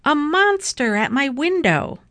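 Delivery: high cry of alarm, acted in storytelling; alarmed shout. A narrator's reading. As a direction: call out that you've seen a monster. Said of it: "shouted Baby".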